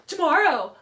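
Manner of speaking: loud mocking retort; mock cold reply. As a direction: throw the word back at him, loud and cutting.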